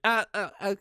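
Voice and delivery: high, halting stammer; flustered stammer. Retold or imitated. Teller imitates a character caught eyeing a dancer, flustered stammer. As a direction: stumble over broken, flustered syllables.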